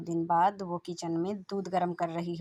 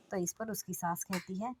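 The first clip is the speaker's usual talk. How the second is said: plain reading voice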